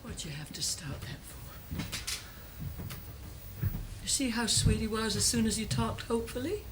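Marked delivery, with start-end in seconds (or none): 0.0-1.8 slow, very soft scolding; hushed reproach. Acted. Mother reproaches her son after the quarrel, hushed.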